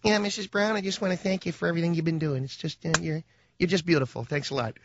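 lightly voiced suck-up kid; mock sweet student. Kid sweetly thanking the third grade teacher, mock sincere. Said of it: "Just go up there and say".

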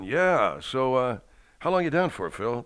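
tossing it off behind the wheel; casual driver. Retold or imitated. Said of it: "one hand on the seat going".

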